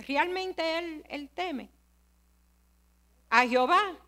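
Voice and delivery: ordinary delivery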